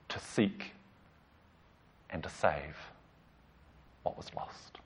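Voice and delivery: soft, unvoiced whisper fading out; whispered Jesus words. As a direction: breathe the words like a secret. Jesus quoted, hushed ending.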